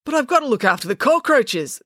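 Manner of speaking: a touch of rising distress. A narrator's reading. Guard worried about leaving the cockroaches, mildly distressed. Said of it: "starting to get distressed".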